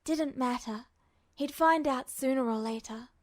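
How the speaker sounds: plain reading voice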